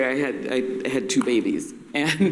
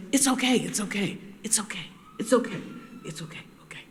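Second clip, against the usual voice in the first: higher-pitched soothing, fading into a whisper; soft voice, fading; 5 semitones higher, 5 dB quieter, faster than usual. Retold or imitated. Father backing down after the mother's scolding, soft voice fading to a whisper. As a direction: trail away from soft murmur to barely audible whisper. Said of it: "actually a soft voice".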